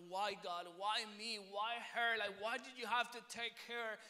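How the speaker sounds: re-enacted anguished protest; angry questioning. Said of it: "I was just angry and I was frustrated".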